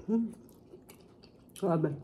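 chewing while speaking; talking between bites of noodles; mouth full. Real, not acted. Seen 1: Chewing with closed mouth after slurping noodles; R frames show no food.

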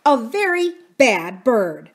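slow, loud, weighty scolding; stern. A narrator's reading. Aunt Polly reprimanding the parrot, stern. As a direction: drive the rebuke home, word by word. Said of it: "she said sternly to the parrot".

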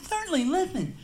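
very high impeded voice; speech impediment voice. Retold or imitated. Bartender proves he talks this way, very high. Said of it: "I talk like this".

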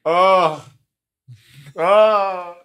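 loud, high, drawn-out imitated groan; mock exasperated groan. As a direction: imitate a kid groaning at hated exercises. Imitates kid hating exercises.

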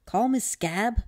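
perky high-pitched growth voice; small creature voice. A narrator's reading. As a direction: introduce yourself as a tiny creature. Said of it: "the voice said".

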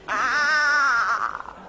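loud high screaming yell; fan's scream. Retold or imitated. Neighbour yelling at a match, loud high scream. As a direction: scream like you scored yourself. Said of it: "grita que parece que juegue él".

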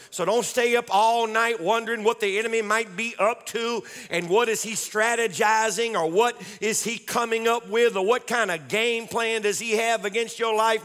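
ordinary delivery

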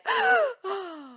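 high startled gasp; sharp pretend gasp of fright; playful fake fear gasp for the class; mock scared gasp. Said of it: "give me scared".

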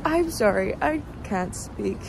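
ordinary delivery

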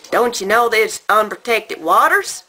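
put-on loud, high Southern-accented challenge; incredulous question in a put-on Southern accent; American Southern accent. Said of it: "this is my best American Southern accent".

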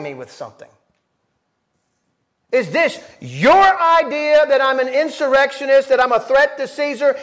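high, loud pressing questions; Jesus confronting Pilate, high and forceful; challenging Jesus. Acted. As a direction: push back at Pilate in his court. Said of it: "Jesus answered".

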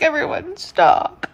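loud high voicing the dog; dog's voice. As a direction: speak for the dog. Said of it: "She's like".

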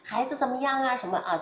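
lightly voiced, fast, fake-friendly politician greeting; a hint of a glad-handing politician chatting up voters; mock politician small talk. Commentator mocking politicians' stale folksy greetings to voters. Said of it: "那些政客老说那种家常话".

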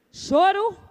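usual speaking voice

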